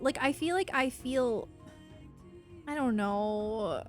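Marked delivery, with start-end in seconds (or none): none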